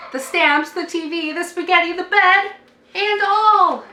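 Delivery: rising cumulative chant; building refrain. A narrator's reading. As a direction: build the list to a big finish.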